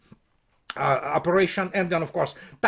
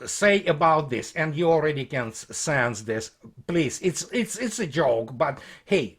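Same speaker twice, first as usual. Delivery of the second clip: normal talking voice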